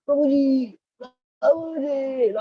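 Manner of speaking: high, loud desperate calling; desperate plea. Retold or imitated. Distressed student begging the monk for help, desperate. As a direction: plead with the name like a drowning man. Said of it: "he was quite desperate".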